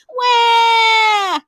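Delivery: high, loud hammed-up wail; mock crying wail. A narrator's reading. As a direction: bawl like a little kid. Child crying.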